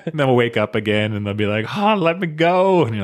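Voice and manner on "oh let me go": high, slow pleading whine; mock whiny captive pleading; whiny plea. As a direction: beg to be let go in a high voice. Bounty target pleading with Arthur.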